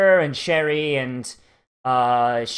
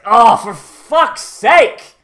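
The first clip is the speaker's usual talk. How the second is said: slow, loud, higher fed-up shout; exasperated yell; 6 semitones higher, 9 dB louder, much slower than usual. Retold or imitated. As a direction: let it rip like a commander at his wits' end. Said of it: "that sort of tone".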